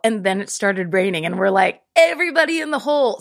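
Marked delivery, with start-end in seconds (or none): 2.0-3.2 high, quick mock rallying call; excited rallying cry. Kids piling into a muddy hole in the rain, excited rallying cry. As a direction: call the gang in, high and fast.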